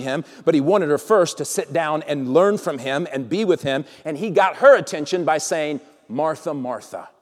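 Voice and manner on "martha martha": soft, lower heartfelt address; deep feeling. Acted. Jesus calling a good friend, soft and heartfelt. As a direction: tenderly get her attention. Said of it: "say it with deep feeling".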